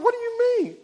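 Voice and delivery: very high, loud mock bafflement; acted incredulous question. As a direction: re-enact a baffled reply, voice shooting up. Acts out his puzzled reply.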